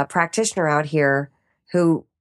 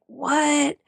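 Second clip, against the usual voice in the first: incredulous; 8 semitones higher than usual. Retold or imitated. Learning parmesan is out.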